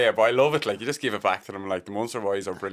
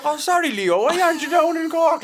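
apologetic Cork fan; 7 dB louder than usual. Retold or imitated. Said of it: "he's like".

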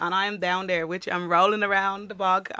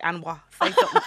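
mock confrontational challenge; 3 dB louder, faster than usual. Dubliner to staring delivery man, confrontational challenge. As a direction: challenge him to speak up.

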